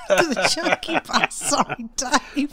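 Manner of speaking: slow, laughing through the words; drawn out amid giggles. Real, not acted.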